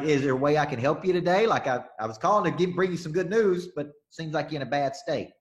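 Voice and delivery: overly friendly caller brightening someone's bad day; upbeat phone pitch. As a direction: sound like you're bringing good news. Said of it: "I'm like".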